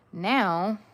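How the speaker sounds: ordinary talk, nothing marked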